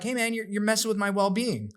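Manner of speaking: high-pitched mock complaint; retold frustration. Agent protesting about industry changes, mildly frustrated high-pitched complaint. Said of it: "I was kind of, like, frustrated".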